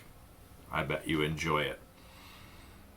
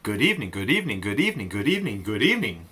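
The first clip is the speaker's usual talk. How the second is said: tongue-twister chain, bouncing up and down; rapid repetition; faster than usual. Shown as a demonstration. Teacher tries good evening many times in a row, rattled off. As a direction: run them together without a gap. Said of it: "a whole bunch of times in a row".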